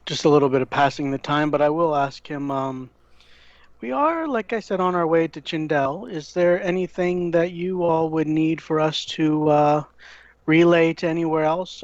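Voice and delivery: ordinary talk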